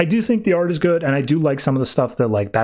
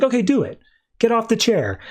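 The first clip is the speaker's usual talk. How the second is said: higher-pitched retold dare; mild challenge; 8 semitones higher than usual. Alfred daring Batman to leave the chair, mildly insistent. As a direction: issue a lightly acted challenge.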